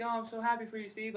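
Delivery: high-pitched imitation of a delighted mother; happy mom. Mom congratulating her son on his plan, happy. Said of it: "She's like".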